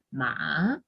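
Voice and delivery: "ma" dipping low, then rising; full third tone. Shown as a demonstration. Teacher saying the syllable alone, falling-rising "ma". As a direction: scoop "ma" down and up. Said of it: "no digo más que una sola sílaba".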